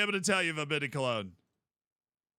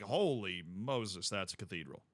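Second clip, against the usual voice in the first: awed hush; 9 semitones lower, 6 dB quieter than usual. Retold or imitated. Describing the Cologne cathedral to a friend, hushed awe. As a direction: let awe drain the voice down. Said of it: "you're just like".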